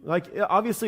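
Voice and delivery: ordinary talk, nothing marked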